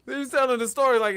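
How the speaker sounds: ordinary talk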